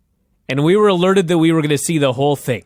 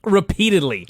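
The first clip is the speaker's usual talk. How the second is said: ordinary delivery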